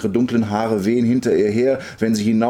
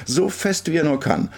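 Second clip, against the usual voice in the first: higher, urging repetition; insistent call; 7 semitones higher than usual. A narrator's reading. Girl wants stronger pushes, calling out. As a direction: press the demand, voice raised in pitch. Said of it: "ruft sie".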